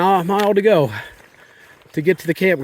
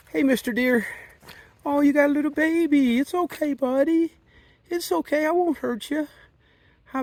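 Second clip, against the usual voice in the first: very high, soft soothing coo; calm a frightened mother deer; soothing a scared animal; 9 semitones higher, 4 dB quieter than usual. Real, not acted.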